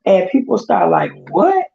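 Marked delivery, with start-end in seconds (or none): 1.3-1.6 high-pitched incredulous what; sharp jump up in pitch, mock astonishment; retold shock. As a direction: mimic people reacting in disbelief. People reacting to surprising news.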